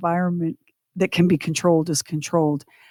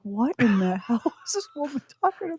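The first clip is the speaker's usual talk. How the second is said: baffled husband, laughing; 8 semitones higher, 4 dB quieter, faster than usual. Retold or imitated. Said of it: "he's looking at me like".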